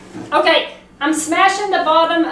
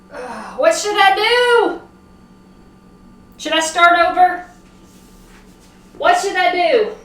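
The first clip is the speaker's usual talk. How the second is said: dismayed; 4 semitones higher, 4 dB louder than usual. Real, not acted. Dismayed by lumps, unsure what next.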